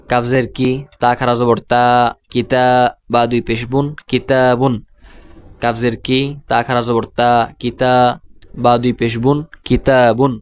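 long "taa" inside কিতাবুন; spelled-out madd recitation. Shown as a demonstration. Teacher demonstrates word reading, তা in কিতাবুন drawn out. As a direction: build কিতাবুন piece by piece, stretching তা. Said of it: "আমি উচ্চারণ করছি".

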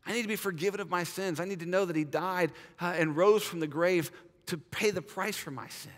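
ordinary delivery

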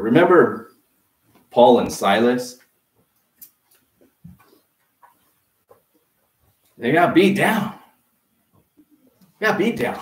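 preaching at full force. Acted. Pastor crying out to his congregation, loud preaching. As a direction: build it up and hammer the point home.

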